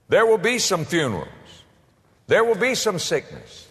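normal talking voice

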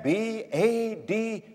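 B A D spelled slowly, letter by letter. Shown as a demonstration. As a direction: spell out B A D with weight. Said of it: "your hap is bad".

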